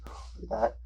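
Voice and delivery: quick hushed whisper; whispered aside. Real, not acted. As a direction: mutter it under your breath.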